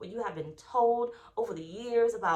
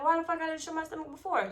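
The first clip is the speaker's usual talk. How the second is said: higher, fed-up exclamation; exasperated; 6 semitones higher than usual. Retold or imitated. Retold thought after surgery, said with exasperated emphasis. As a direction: throw it out, half laughing at herself. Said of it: "And I'm like".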